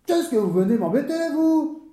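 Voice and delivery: high-pitched angry challenge; indignant rebuff, pitched high; furious. Acted. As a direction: snap at him like an enraged official. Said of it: "parut frapper de folie furieuse".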